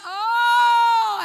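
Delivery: long, high, loud "oh"; loud imitated wail. Preacher imitating someone crying out in church, loud wail. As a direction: imitate a worshipper crying out loudly. Imitates a cry during prayer.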